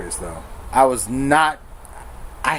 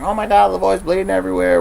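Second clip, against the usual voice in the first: panicked call; 7 semitones higher, faster than usual. Retold or imitated. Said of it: "girlfriend ... called the police".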